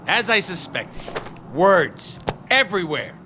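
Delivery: usual speaking voice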